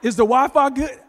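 high, loud comic repeat; mock-urgent shout; shouted punchline. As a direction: holler it like it matters most.

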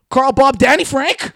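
high, loud, slow disbelieving call; shocked Klansman. Acted. Klansman spots his buddy with a burrito, loud high slow shock. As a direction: catch a member eating a burrito. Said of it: "pretending you were Danny Frank Bob Jim".

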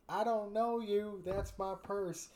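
put-on high, slow shrill-kid shout; mock shout. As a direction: yell it like a panicked boy. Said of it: "he would just shout".

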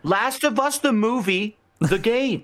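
high, held-up silly announcement; mock pitch. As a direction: pitch a joke title in a high, sing-out voice. Jokingly pitching an absurd title.

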